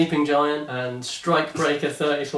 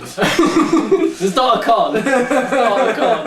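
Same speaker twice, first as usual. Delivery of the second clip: laughing through the denial; can barely say it for laughing; 9 semitones higher, 5 dB louder, slower than usual. Real, not acted. Host laughing while insisting it is no con.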